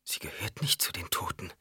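hushed near-whisper; inner thought. A narrator's reading. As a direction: think it in a soft whisper. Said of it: "und Alia dachte". (geflüsterter Gedanke)

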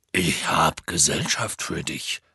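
low, slow announcement; Hagrid. A narrator's reading. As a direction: call it out slowly for a dim giant. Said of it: "rief Hagrid". (langsames, tiefes Ankündigen)

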